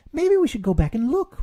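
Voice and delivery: put-on high, loud child pleading; eager Roo. A narrator's reading. Roo begs Kanga to go back, high and loud. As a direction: urge it eagerly.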